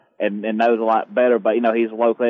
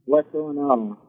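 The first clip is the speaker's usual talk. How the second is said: muffled; 3 semitones higher, 4 dB quieter, slower than usual. Real, not acted. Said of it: "You sound like you're muffled".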